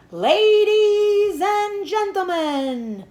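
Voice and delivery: high, loud, drawn-out sing-song opening; loudspeaker voice. A narrator's reading. Fair announcer opening the ceremony, grand sing-song. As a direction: stretch it out like a ringmaster. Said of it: "said the loudspeaker".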